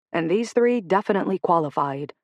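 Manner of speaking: usual speaking voice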